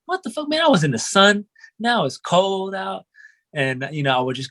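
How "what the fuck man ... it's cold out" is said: high, incredulous complaint; mock outrage. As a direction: squeal it like a cold seven-year-old. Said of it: "I'm like".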